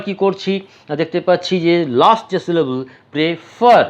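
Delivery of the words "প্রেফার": প্রেফার with the weight on the final FER; stress on last syllable. Shown as a demonstration. Teacher showing where stress falls, প্রেফার stressed on FER. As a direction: lean hard on the FER of প্রেফার. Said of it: "আমি জোর দিয়েছি".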